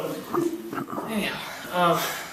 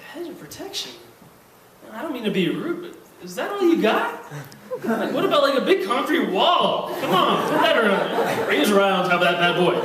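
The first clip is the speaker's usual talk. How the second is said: high mocking scoff at the hedge; mocking scoffer; 3 semitones higher than usual. Scoffing at a hedge of protection, in a high mocking voice. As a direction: sneer at the hedge, voice pitched high. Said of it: "It's like".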